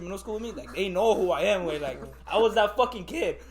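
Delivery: normal talking voice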